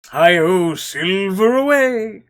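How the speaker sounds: loud, slow cowboy holler, cartoonish; hammy cartoon cowboy call; wacky cartoon voice. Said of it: "like a wacky cartoon character".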